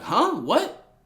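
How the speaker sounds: high, startled double take; imitated high-pitched disbelief; mock surprise. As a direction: act out a baffled reply. Acting friend's startled reply.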